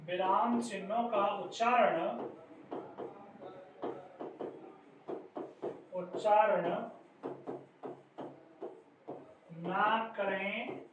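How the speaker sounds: slow, phrase by phrase with long gaps; drawn-out words, long pauses between; slow dictation. Real, not acted. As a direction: dictate it bit by bit as if writing along. Dictating slowly while writing it out.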